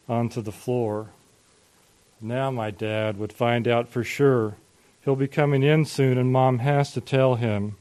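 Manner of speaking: ordinary delivery, nothing marked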